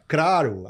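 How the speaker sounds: "craro" with a caipira R in place of L; Chico Bento's caipira speech. Shown as a demonstration. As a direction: say "craro" as rural caipira dialect. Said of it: "o Chico vai falar assim".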